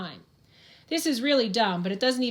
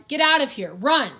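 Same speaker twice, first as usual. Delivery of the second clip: loud, higher urgent yell; shouted warning; 5 semitones higher, 7 dB louder than usual. Acted. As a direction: bellow at them to get out. Sheriff yelling to flee collapse.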